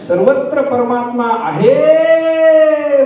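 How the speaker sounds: high, loud, hugely stretched final word; donkey bray. Shown as a demonstration. Preacher voicing what the donkey says first, long bray. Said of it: "गाढवाचं ओरडणं म्हणजे तो असं म्हणतो".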